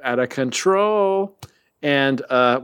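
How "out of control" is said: high, slow, stretched out of control; slogan-style catchphrase. Acted. Host hams up the t-shirt line, high and slow. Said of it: "we should make that a t-shirt".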